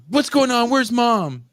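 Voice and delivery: high-pitched mock cry of alarm; alarmed daughter. Daughter barging into the room looking for mom, alarmed.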